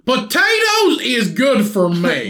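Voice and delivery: slow, high hillbilly drawl; appalachian accent. Acted. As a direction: stretch it out like a backwoods hillbilly. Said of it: "Say that in your hillbilly voice".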